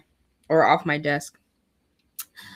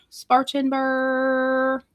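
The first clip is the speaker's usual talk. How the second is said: drawn out; 5 semitones higher, much slower than usual. Shown as a demonstration. Streamer reading the town name aloud, drawn out slowly. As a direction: stretch Spartanburg out slowly, sing-song. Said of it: "drawn-out place name".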